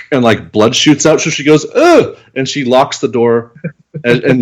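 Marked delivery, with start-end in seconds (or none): 1.7-2.2 high, loud mock "Ugh!" of disgust. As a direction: squeal out a grossed-out ugh. Girl disgusted by blood.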